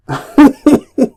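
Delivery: high, loud laugh; burst of laughter. Real, not acted. Reader cracking up over the script, bursting out laughing. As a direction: crack up helplessly.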